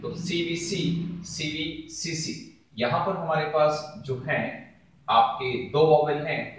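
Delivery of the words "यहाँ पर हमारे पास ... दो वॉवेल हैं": ordinary talk, nothing marked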